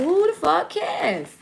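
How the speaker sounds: high, drawn-out indignant holler; exaggerated shout at nosy commenters; loud outburst. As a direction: holler it, stretching the words.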